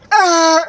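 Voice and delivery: short loud, high bawl; mock crying wail. Imitating man crying over dropped chicken sandwich, mock wail. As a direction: burst out in a fake sob.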